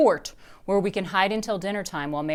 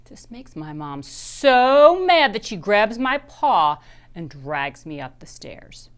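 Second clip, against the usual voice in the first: plain reading voice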